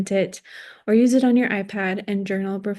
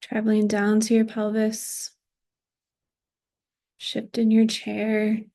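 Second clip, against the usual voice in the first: guided body scan. Acted. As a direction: guide them gently through the body, phrase by phrase. Leads a body scan meditation.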